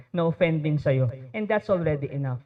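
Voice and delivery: ordinary talk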